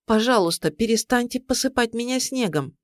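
mildly indignant plea, pitched high. A narrator's reading. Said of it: "с негодованием сказала Мариамне".

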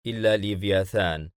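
ordinary delivery, nothing marked

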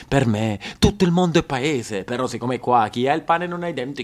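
usual speaking voice

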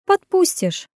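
high, knowing woman's voice; confident purr. A narrator's reading. Woman overruling his refusal, purring and high. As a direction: purr the contradiction, pitched right up. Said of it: "прищурившись, проурчала она".

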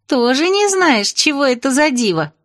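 pleased smirk. A narrator's reading. Princess smug that narrator doesn't know, pleased. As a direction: tease her, pleased to know better. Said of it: "довольно усмехнулась княжна".

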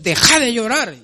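mock telling a child off; a hint of a scolding parent. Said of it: "se regañan a los niños".

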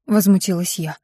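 ordinary delivery, nothing marked

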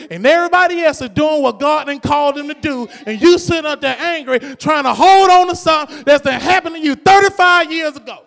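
rising to a loud preaching cry; preaching at full force. Real, not acted.

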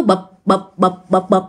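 evenly spaced little thuds; bụp as a repeated sound effect; imitated hopping can. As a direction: mimic a can bumping along behind. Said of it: "có cái tiếng động nó cứ đi theo".

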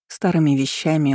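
ordinary delivery, nothing marked

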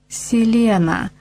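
breathy vintage purr. A narrator's reading. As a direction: purr the name slowly, luring. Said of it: "промурлыкала она". (мурлыкающий, вкрадчивый голос)

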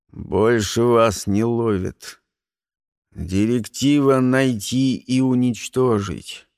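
low, slow and detached; cold agent voice. Acted. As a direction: deliver it like a cold-blooded agent. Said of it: "услышав слова агента".

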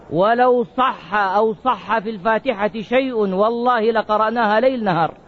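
usual speaking voice

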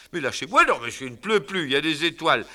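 high loud exasperated husband; irritated protest. Acted. Husband shaking off Fadinard, exasperated. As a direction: push him off, fed up.

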